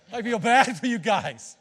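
slow teasing through a laugh; laughing at the other teams' fans. Real, not acted. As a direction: chuckle while you pity them.

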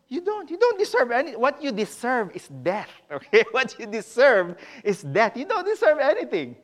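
loud, emphatic sermon rebuke; forceful preaching. Real, not acted. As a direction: drive the rebuke home to the congregation.

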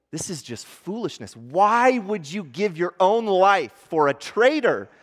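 voicing a villain's incredulous taunt; scornful disbelief.

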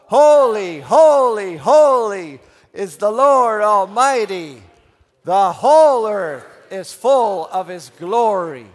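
high, slow, booming proclamation; loud voice. Shown as a demonstration. Preacher leading both sides the third time, loud voice. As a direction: shout it out to fill the room. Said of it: "say it in a loud voice".

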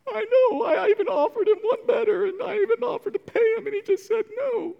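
high, sobbing whine; blubbering king. Retold or imitated. Ahab sobbing to Jezebel, high blubbering whine. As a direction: cry like a spoiled little girl. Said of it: "He cries like a little girl".

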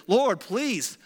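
understated begging; pleading. A narrator's reading. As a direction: plead slowly, drawing out each word. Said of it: "Moses again pleaded".

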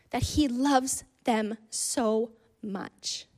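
"loves them so much" spaced out slowly, word by word; slow emphatic. Real, not acted. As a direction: pause between "them", "so" and "much". Preacher drives the point home.

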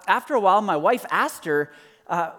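normal talking voice

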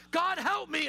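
high-pitched cry to God; acted desperate plea. Preacher voicing Jonah's prayer from the sea, acted desperate plea. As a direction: plead urgently for rescue.

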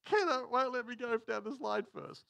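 put-on high, small child voice; a hint of a sobbing child; little girl crying. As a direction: whine it like a hurt little girl. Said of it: "She goes".

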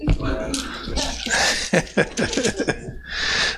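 real hearty laughter; big laugh. Real, not acted. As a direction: laugh off the joke about Mike. Laughs at Mike.

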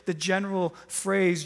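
normal talking voice

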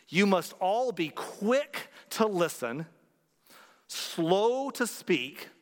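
very slow, weighty verse reading with long pauses; slow scripture reading. Acted. Pastor reading James to the congregation, slow and solemn. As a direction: let each phrase of the verse land slowly. Reads the Bible verse aloud.

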